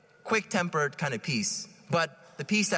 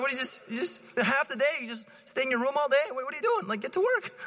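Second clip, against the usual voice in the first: mock exasperated scolding; 7 semitones higher, faster than usual.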